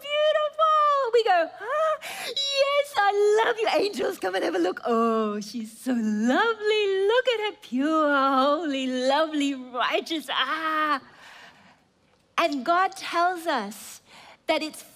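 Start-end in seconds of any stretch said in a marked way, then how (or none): 0.0-4.8 very high, loud, tearful cry of love; God overjoyed. Acted. God crying out his love to his child. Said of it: "he's there going". 4.8-11.0 lightly voiced adoring God, cooing; God admiring. God showing the angels his lovely child. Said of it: "he's there going".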